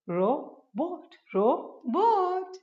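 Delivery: "रोबोट" broken into ro, pause, bot, the last rising; split syllables. Shown as a demonstration. Teacher drilling two-syllable words, "रोबोट" split as ro - bot. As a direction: say each "रोबोट" in two separate beats. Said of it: "दो syllable से बने हैं".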